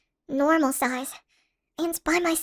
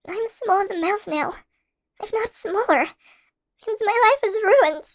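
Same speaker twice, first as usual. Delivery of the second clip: building from mild worry to loud, higher despair; rising distress; 5 semitones higher, 4 dB louder than usual. Acted. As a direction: let the panic climb line by line. Growing despair about being tiny.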